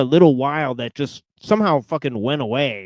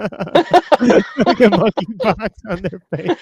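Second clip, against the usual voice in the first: high, loud laughter through words; squealing with laughter, barely speaking; laughing hard; 8 semitones higher, 8 dB louder than usual. Real, not acted. Cracking up at the remark.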